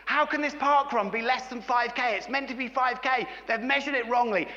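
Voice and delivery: worked-up mock outrage, pitched high; super agitated. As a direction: imitate an indignant runner complaining. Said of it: "he was getting super agitated".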